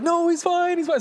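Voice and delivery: very high mock woman's voice; high-pitched retold shout back; imitated neighbour's reply.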